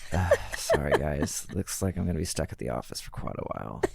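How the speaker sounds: low, soft, fake-weary voice; tired voice. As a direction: sigh it like you're exhausted. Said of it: "intentionally tired sounding voice note".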